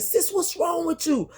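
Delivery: lightly voiced worried friend; concerned friend. Friend asking her in the stall, light high worry. Friend asks what's wrong.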